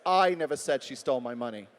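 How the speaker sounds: "I" leaned on hard at the start; stress on I. Shown as a demonstration. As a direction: make "I" the loud, prominent word. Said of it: "it wasn't me who said it".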